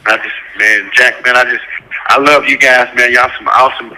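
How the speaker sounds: usual speaking voice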